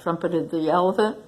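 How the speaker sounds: ordinary delivery, nothing marked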